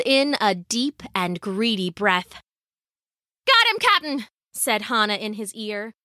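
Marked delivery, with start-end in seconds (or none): 3.4-4.3 high-pitched sailor's call; salty old hand calling to the captain; pirate woman, triumphant. A narrator's reading. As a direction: report the rescue in pirate tones.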